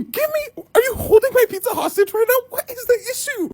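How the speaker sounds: high-pitched incredulous squeal; indignant. Retold or imitated. Customer accusing the shop of holding pizza hostage, indignant.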